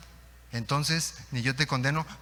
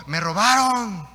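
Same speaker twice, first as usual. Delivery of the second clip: drawn-out, louder, higher cry of complaint; retold outcry; 5 semitones higher, 6 dB louder, slower than usual. Robber discovers his car is gone, retold outcry. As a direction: cry it out slowly like a victim. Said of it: "Y gritó y dijo".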